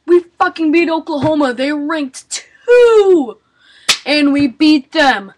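loud, high victory yell; excited yelling. Real, not acted. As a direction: shout it like your team just won. Celebrating football win.

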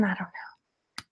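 slow whispered aside to herself. Real, not acted. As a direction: breathe it out as a private doubt. Muttered aside about fashion.

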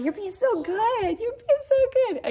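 high, slow praise for the dog; doting praise. Real, not acted. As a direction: lift it up and stretch it out sweetly.

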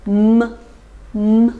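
"m" as a closed-lip nasal hum; nasal sound demo. Shown as a demonstration. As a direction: press the lips shut and sound "m" alone. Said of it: "鼻音". (闭上嘴唇发鼻音“m”)

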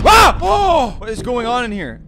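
high, loud scream then yell; terrified scream. Real, not acted. Player startled in a dark room, loud high scream. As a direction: scream in real fright.

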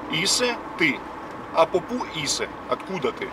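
ordinary talk, nothing marked

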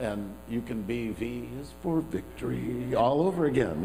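ordinary delivery

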